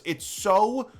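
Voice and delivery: real frustrated outburst, dragged out; angry rant. Real, not acted. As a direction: vent at the game designers. Ranting about the game.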